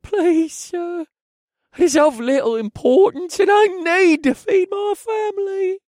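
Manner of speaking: high, loud desperate pleading; pleading thief. Acted. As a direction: beg for mercy.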